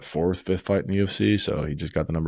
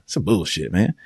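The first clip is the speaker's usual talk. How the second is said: ordinary delivery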